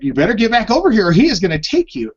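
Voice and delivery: put-on fast, rushed warning to the child; quick mock scold from the mother; scolding mom. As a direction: hurry the kid back with a threat. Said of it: "she's like".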